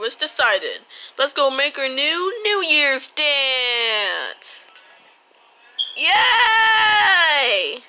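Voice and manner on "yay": excited cheer. Real, not acted. Cheering with friends about the New Year's dance, loud. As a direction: shout a happy yay, much higher.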